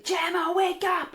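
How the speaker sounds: high, stretched wake-up call; shouting. Retold or imitated. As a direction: call out urgently to rouse a sleeper. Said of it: "And I'm shouting".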